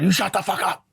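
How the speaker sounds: hammed-up mom snapping at her son; angry mom. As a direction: play the furious mom pinning him to the wall, voice up high. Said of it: "I was like".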